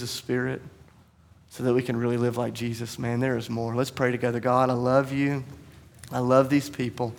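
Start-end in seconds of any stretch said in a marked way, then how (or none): 4.4-7.1 soft, slow, reverent prayer; closing prayer. Real, not acted. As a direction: pray quietly over the congregation.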